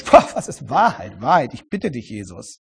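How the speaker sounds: lightly voiced scornful sneer; mocking. Shown as a demonstration. Pilate scoffing at Jesus, lightly mocking. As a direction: scoff at the question, dismissive. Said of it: "Vielleicht fragt er spöttisch".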